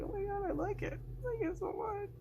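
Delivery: high squealing delight; high-pitched swooning gush. Retold or imitated. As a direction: squeal it like you're about to melt. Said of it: "I'm just like".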